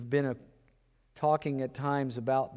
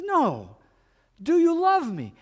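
insistent Jesus; 12 semitones higher, 4 dB louder than usual. Retold or imitated. Jesus asks again.

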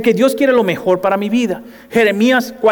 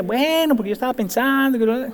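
higher, softer hedging; hesitant backpedal; 5 semitones higher, 5 dB quieter than usual. Retold or imitated. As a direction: wriggle out of the promise. Congregant backing off. (titubeo, más agudo y suave)

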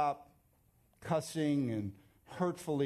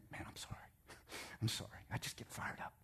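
soft retold whisper of sorry; hushed, sheepish retold apology; 3 semitones lower, 13 dB quieter, faster than usual. Fan apologizing to the preacher after his tirade, whispered. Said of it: "He kept saying".

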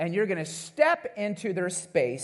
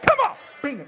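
mock fighter taunt; 9 semitones higher, 7 dB louder, faster than usual. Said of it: "They're like".

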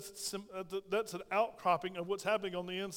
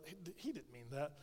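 sly whisper; 4 semitones lower, 13 dB quieter than usual. Retold or imitated. Satan whispering doubt.

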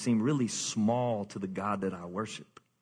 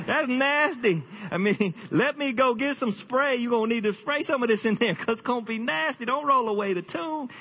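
disgusted Martha; 10 semitones higher than usual. Acted. Martha begging Jesus not to open the stinking tomb, high and laughing. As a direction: recoil from the smell as the comic sister.

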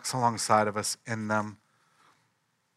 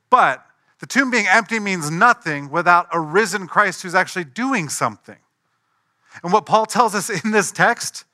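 loud, higher, full-force sermon delivery; fervent preaching; 5 semitones higher, 7 dB louder than usual. Acted. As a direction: proclaim it to the whole room.

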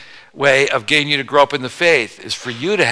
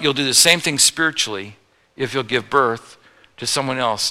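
ordinary talk, nothing marked

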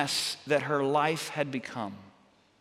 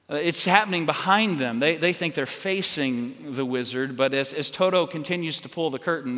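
ordinary talk

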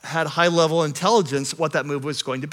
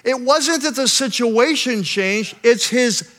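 louder, rising sermon build to a climax; preaching at full force; 8 semitones higher, 6 dB louder, slower than usual. Acted. Pastor to his congregation, preaching at full force. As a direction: preach it, lifting the room. Sermon point driven home.

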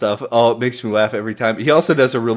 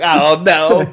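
mock wrestling promo; 5 semitones higher, 5 dB louder than usual. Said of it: "I start saying it like that in my head".

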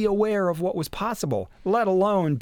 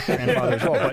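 laughing; 4 dB louder than usual. Real, not acted.

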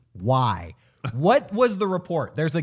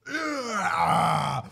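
creature groan, mock menace; guttural mock-monster groan; imitated alien groan. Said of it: "expecting them to be like".

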